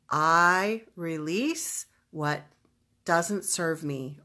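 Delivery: slow, deliberate declaration with firm emphasis; emphatic and drawn-out; powerful declaration. Shown as a demonstration. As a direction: declare it forcefully, stretching each word. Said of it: "very emotionally powerful declaration".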